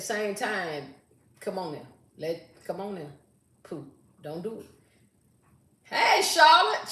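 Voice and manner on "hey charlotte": high, loud, drawn-out hello; excited greeting. Real, not acted. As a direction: call out to her with delight. Greeting a viewer.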